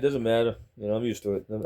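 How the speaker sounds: usual speaking voice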